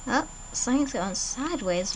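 ordinary talk

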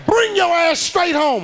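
loud, slow, high mock-yelling mother; mother yelling at her son to come straight home; hammed-up furious mom. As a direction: bark it out like an enraged mom over the phone. Mother ordering, slow shout.

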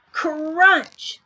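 high, loud storybook crunch; exclaimed sound word. Acted. Reader voices the shark biting the table, loud exclamation. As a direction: punch out the crunch like a big bite.

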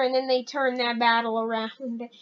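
ordinary talk, nothing marked

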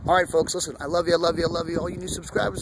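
usual speaking voice

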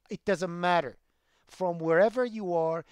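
normal talking voice

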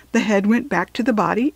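usual speaking voice

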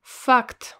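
Fact with an open Russian a; Fact, loud and clear. Shown as a demonstration. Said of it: "Next is the word fact".

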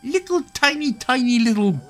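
high cartoon squeak; squeaky tiny voice. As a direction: talk like you've been shrunk to jelly-bean size. Squeaky shrunk-person voice.

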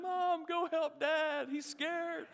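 slow, high mock-crying child; imitated crying toddler. Three-year-old twins pleading with Mom, mock crying. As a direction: wail it like a little child, drawn out. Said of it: "and they're crying".

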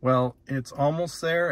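ordinary delivery

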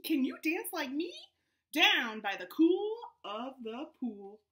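lively storybook read, voices up high; animated read-aloud to a Zoom class; reading to children. Acted. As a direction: read it out bouncy for little kids. Reads picture book aloud to kids.

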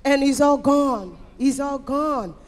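ordinary talk, nothing marked

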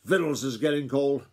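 high, loud dinner call; shouted interruption. A narrator's reading. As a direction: holler it as if through a megaphone. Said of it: "as though she was shouting through a megaphone".

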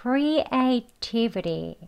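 "creativity" relaxed, the t flapped to an r, as "creatibiri"; slow native-style "creativity" with a tapped t; soft flapped t. Shown as a demonstration. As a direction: loosen the tongue on "creativity", "-viri" at the end. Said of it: "리라고 발음하세요".

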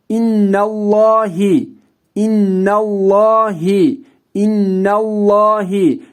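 innallahi with a full, dark lam; thick lam. Shown as a demonstration. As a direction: read innallahi with the lam heavy. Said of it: "Evet, kalın okudum".

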